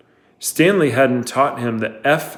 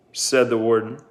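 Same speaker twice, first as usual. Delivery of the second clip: usual speaking voice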